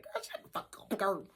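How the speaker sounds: breathless whisper of disbelief. Retold or imitated. Whispering to the coworker in shocked disbelief. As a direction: hiss it under your breath. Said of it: "I said".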